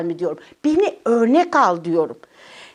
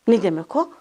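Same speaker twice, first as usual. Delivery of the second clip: ordinary delivery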